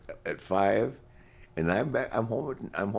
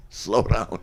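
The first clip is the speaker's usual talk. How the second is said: louder plea; 5 semitones higher, 6 dB louder than usual. Retold or imitated. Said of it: "I went, "Uh".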